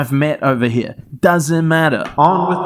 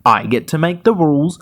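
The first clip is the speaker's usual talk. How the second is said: usual speaking voice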